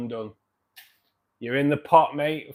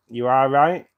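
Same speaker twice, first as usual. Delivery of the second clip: emphatic; 3 semitones higher, 12 dB louder than usual. Real, not acted. Emphatic agreement with a chat comment.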